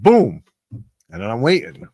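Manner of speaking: usual speaking voice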